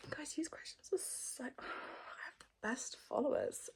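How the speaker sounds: soft unvoiced whisper to the viewers; whispered aside. Real, not acted. Whispering fondly to her followers about their questions. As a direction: lean in and whisper it.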